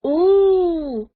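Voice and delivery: high, loud wondering oh; children marvel together; impressed oh. A narrator's reading. Children impressed by the denbu, high loud oh. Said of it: "said the children, impressed".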